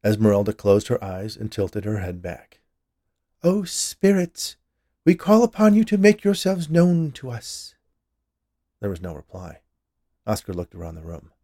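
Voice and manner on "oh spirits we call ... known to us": high, loud séance invocation; summoning medium. A narrator's reading. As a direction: call out grandly to the spirits.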